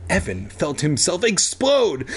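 usual speaking voice

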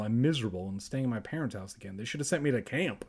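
fast, soft self-mocking rush; rushed aside. Real, not acted. Narrator joking about his own life, fast. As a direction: rattle it off quickly. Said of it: "rushed self-deprecating aside".

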